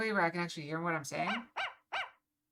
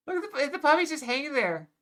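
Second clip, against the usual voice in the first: delighted gushing; 6 semitones higher, 7 dB louder than usual. Real, not acted. Streamer delighted by dangling puppy.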